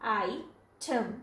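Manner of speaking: "आई ठम" slowly split after आई; broken into syllables. Shown as a demonstration. Teacher showing where to break the spelling, syllable by syllable. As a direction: say "आई ठम" in two slow, separate syllables. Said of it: "आई पे रोक सकते हैं और ठम पे".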